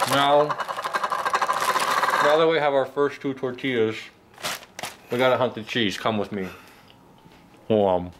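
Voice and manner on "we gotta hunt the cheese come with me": ordinary delivery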